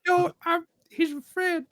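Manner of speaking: put-on high, tearful little boy begging; a scared kid protecting his pet, high and loud; pleading child. GM voicing the boy pleading for his bird, high tearful. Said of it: "and he goes".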